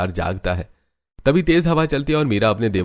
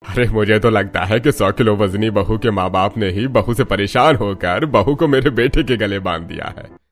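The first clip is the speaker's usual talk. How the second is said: put-on father-in-law voice, taunting; father-in-law making a jibe; mocking father-in-law; 3 dB louder than usual.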